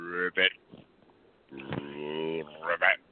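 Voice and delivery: deep croaking ribbit; frog voice. Pretend. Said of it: "Oh, no, he's turned into a frog".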